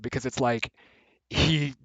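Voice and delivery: ordinary talk, nothing marked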